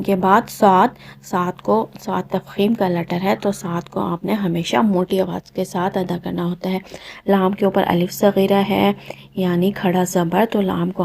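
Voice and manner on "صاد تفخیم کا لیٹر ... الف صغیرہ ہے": usual speaking voice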